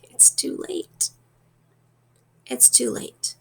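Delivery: faint breathy whisper of a dying man; weak whisper. Retold or imitated. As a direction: barely voice it, spent and resigned. Dying minister answers Chillingworth.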